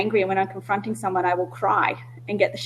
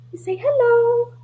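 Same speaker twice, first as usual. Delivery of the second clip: much higher, slow cooing hello; pet talk; 14 semitones higher, slower than usual. Real, not acted. To her cat on the call, high slow cooing.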